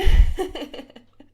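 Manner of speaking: loud, high burst of laughter; real explosive laugh, then fading. Real, not acted. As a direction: crack up at your own remark. Laughs at own remark.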